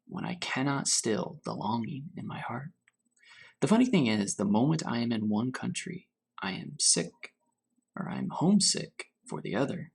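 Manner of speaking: unhurried storybook reading to children, lightly voiced; story reading. A narrator's reading. Teacher reading Grandfather's Journey to her class, gentle storytelling. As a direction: read it gently, letting each image settle. Teacher reads picture book aloud.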